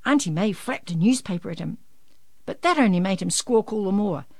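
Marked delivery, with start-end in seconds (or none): none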